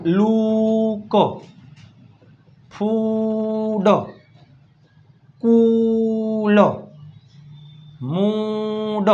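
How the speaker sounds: very slow, oo held long in "લુક"; exaggerated long oo. Shown as a demonstration. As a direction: draw "ફૂડ" right out on a level oo. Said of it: "double ઓ આવતું હોય ત્યારે એનો ઉચ્ચાર".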